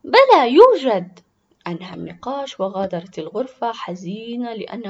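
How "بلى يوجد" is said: put-on high, loud, drawn-out angry child; indignant little girl's shout; angry insistence. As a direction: insist furiously, stretching the words. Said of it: "غضبت وقالت".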